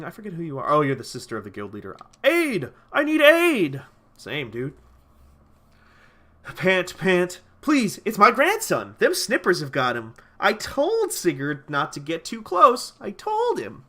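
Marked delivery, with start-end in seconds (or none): 2.2-3.8 high, loud call for aid; hammed-up plea, pitched high; cry for help. Acting out a quest cry for help. 6.6-13.8 high, loud, acted distress; hammed-up quest-giver in distress; desperate plea. Acting out a grandparent's desperate quest dialogue.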